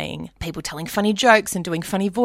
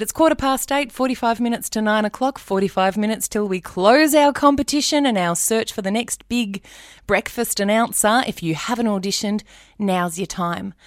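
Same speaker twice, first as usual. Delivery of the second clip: bright, higher and louder radio host read; radio announcer; 4 semitones higher, 4 dB louder than usual. Retold or imitated. Imitating the breakfast announcer calling for auditions. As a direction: pitch it like a breakfast DJ. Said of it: "the brekkie announcer, said".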